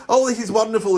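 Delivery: lightly voiced chirpy host; chirpy lady. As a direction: gush like an effusive presenter. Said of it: "chirpy, nice lady".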